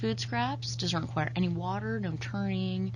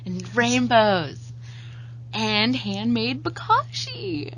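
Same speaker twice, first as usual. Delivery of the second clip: playful hype; 5 semitones higher, 6 dB louder, slower than usual. Acted. Hyping the bucket as magic.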